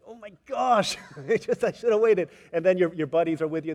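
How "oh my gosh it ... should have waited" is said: high, loud, half-laughing groan of regret; frustrated excited outburst. Retold or imitated. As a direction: kick yourself out loud with a laugh.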